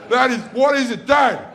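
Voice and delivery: put-on high, eager begging child; excited sons pestering dad; pleading kids. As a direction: kids desperate to know. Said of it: "we're just going".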